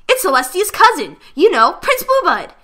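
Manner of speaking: high, loud yell from the crowd; excited yell. Acted. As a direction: shout the news to everyone. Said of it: "yelled another".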